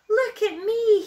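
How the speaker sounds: high, loud show-off call; excited little bear calling to be watched. A narrator's reading. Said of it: "he called".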